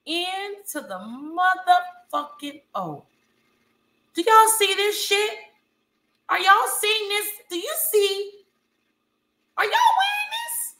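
high, incredulous squeal; shocked. Real, not acted. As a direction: squeal in disbelief. Disbelief at hoops.

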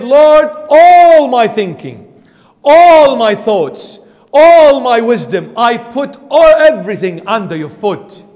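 loud, high cry of surrender, "all" drawn out; fervent plea. Acted. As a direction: pour it out to the Lord, stretching each "all". Preacher acts Mary's fervent surrender.

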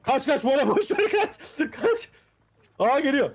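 high frantic warning; panicked, being chased. Real, not acted. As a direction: scream at them to flee. Killer approaching teammate.

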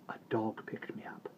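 soft whisper. A narrator's reading. Boy telling his father about the dog, soft whisper. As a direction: breathe it out quietly.

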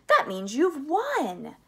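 high, loud, stretched-out cry; slow, soaring exclamation; exploding with excitement. A narrator's reading. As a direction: announce the win jubilantly. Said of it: "suddenly exploded with excitement".

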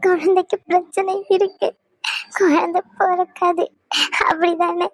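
sobbing, pleading questions; crying. Acted. Wife begs silent husband to confirm bad news, crying. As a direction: plead with tears breaking between phrases. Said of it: "நீ அழக்கூடாதுன்னு சொல்லுவேன்ல".

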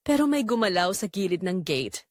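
normal talking voice

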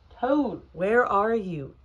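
loud searching call; high first call, strongly projected; crying out. A narrator's reading. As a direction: call into a dark house for a friend. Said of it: "cried Frog, called Frog".

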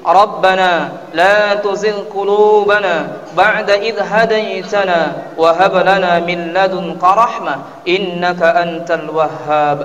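ordinary talk

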